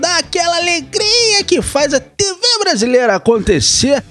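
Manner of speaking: high, drawn-out TV presenter voice; hyped show host. Pretend. Co-host opening the news segment, mock TV announcer.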